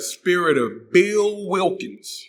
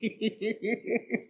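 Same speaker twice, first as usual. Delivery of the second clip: laughing; 9 semitones higher, 11 dB quieter than usual. Real, not acted. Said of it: "or I'm scared".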